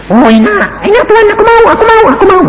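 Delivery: a child voiced eagerly wanting to stay in heaven. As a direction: beg like a child who wants it.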